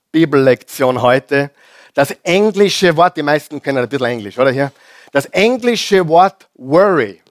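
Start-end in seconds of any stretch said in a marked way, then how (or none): none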